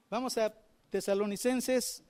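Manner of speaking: ordinary talk, nothing marked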